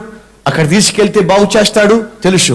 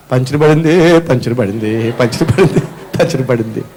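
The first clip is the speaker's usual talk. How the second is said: mock crying lament; 7 semitones lower than usual. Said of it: "ఏడుస్తారా?".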